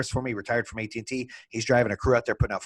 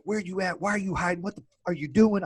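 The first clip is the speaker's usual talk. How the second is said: higher, pressing angry questions; retold chewing-out, pitched up; angry demands; 6 semitones higher than usual. Demanding to know where partner Kevin is hiding, angry. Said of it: "Well, I'm pissed".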